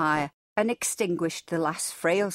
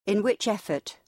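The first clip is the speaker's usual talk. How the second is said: ordinary delivery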